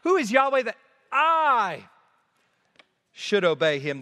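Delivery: self-exalting emphasis on I; stress on I. Shown as a demonstration. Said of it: "is the emphasis on I".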